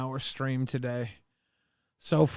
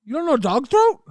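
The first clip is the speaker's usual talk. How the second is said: squeaky mock outrage; high teasing voice; 13 semitones higher, 6 dB louder than usual.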